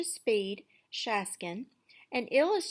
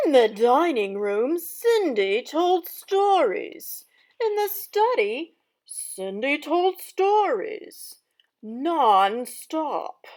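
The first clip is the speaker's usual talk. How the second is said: loud, lively storybook narration; dramatic story reading; 9 semitones higher, 15 dB louder than usual. Acted. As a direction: read it aloud like a theatrical stepmother. Teacher reads stepmother's story aloud.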